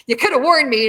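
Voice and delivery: high, loud mock-indignant complaint; playfully outraged retelling; retold reproach. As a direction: act out blaming her for the shock. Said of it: "I was so mad at her".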